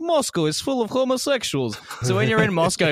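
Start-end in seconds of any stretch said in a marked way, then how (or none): 0.0-1.8 high, odd mocking voice; imitating a Russian in a much higher voice; mock provincial Russian. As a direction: parody a scandalized Russian up high.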